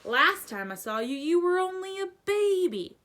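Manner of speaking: put-on fondly remember the baby, booming; fond giant. A narrator's reading. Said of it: "said the giant".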